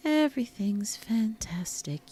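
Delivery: high, slow mock-cheerful whisper. Real, not acted. Reassuring viewers, a slow mock-cheerful whisper. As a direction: whisper it with a mock bright smile.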